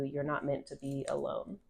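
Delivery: usual speaking voice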